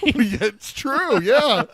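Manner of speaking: real laughter; laughing. Real, not acted. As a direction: agree while still cracking up. Laughing agreement.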